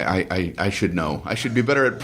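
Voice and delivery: normal talking voice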